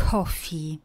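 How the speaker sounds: coffee with a breathy puff on the K; aspirated K. Shown as a demonstration. Teacher models coffee with a breathy aspirated K. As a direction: push air out on the K of coffee. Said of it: "také trošku s přídechem".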